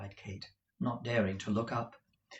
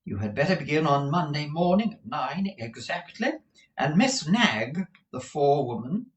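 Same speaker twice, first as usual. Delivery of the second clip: raised, proper employer voice; brisk madam listing terms; businesslike Madame Mantalini; 6 semitones higher, 4 dB louder than usual. Acted. Madam explains meals and wages, pitched up and brisk.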